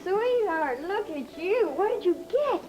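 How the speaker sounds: delighted. Acted. Greeting child, delighted.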